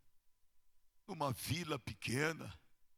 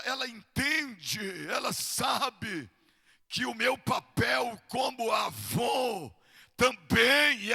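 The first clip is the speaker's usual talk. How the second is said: raised pitch with long-stretched words; impassioned preaching; 7 semitones higher, 10 dB louder, slower than usual. Real, not acted. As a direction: build from high declaration to louder cry.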